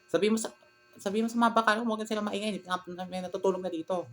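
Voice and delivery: high, sharp scolding aunt, lightly imitated; mock the aunt's annoyed snap; retelling the aunt's irritated tone. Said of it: "alam ko na yung ganun yung tono na boses siya".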